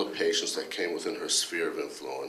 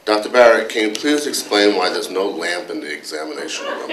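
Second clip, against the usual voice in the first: louder, a touch indignant complaining colleague; Dr. Anderson; 2 semitones higher, 11 dB louder than usual. Retold or imitated. Colleague grilling the department head about hospital rules, mock indignant. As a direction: press the boss with mock exasperation, half-laughing. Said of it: "went something like this".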